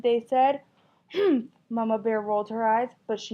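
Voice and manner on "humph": high loud scoff; indignant humph. Acted. Mama bear scoffs at her family, a loud humph. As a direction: scoff with annoyance.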